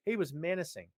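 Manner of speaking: normal talking voice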